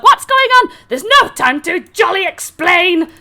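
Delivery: high, loud frantic babble; flustered Harengon. Acted. Jebek fleeing in confusion, high and frantic. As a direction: blurt it in a fluster. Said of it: "Jebek's like".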